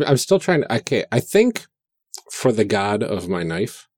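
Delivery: usual speaking voice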